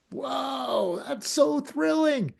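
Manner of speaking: high, thrilled exclamation; excited. Pretend. Imagining change as excitement, thrilled whoa. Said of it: "Is it excitement?".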